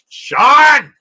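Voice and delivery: loud scolding cry of a name; scolding shout. Real, not acted. Scolding a joke.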